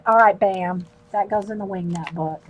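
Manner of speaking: ordinary delivery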